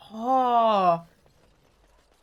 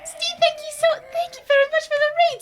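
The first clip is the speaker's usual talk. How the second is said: excited; 7 dB louder than usual. Real, not acted. Excited high greeting to donor.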